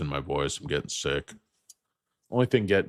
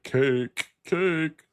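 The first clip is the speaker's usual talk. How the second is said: high, mocking two-word jab; sneering high-pitched impersonation; imitating the realtor guy; 12 semitones higher, 5 dB louder than usual. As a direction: squeak it out like the weird realtor. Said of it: "He's like".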